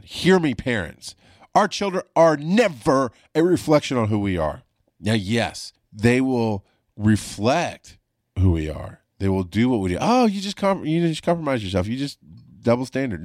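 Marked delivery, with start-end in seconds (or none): 1.5-4.6 raised, higher preaching tone to parents; preachy appeal. Real, not acted. Calling out to listening parents, preaching. 10.0-13.2 high, scoffing imitation of a critic; mock the nitpicker's accusation. Imitating a critic who calls him a hypocrite, mocking.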